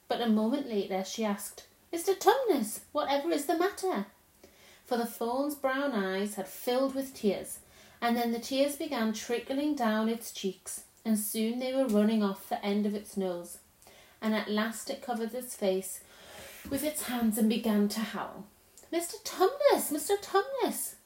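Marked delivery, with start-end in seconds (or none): 1.9-4.1 put-on high, worried little-girl question; alarmed concern for a friend. Lucy sees the faun crying, alarmed and concerned. 18.9-21.0 high, pleading call of the name; distressed little girl calling to a friend; great distress. A narrator's reading. Lucy calls to the howling faun, in great distress. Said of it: "said Lucy in great distress".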